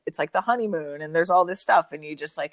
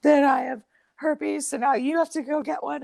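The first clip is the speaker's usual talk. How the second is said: higher, imitating a panicking ex; hammed-up hysterical confession, voice pitched up; mock hysterical; 9 semitones higher than usual. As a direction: ex boyfriend confessing herpes to her, in a mock hysterical voice.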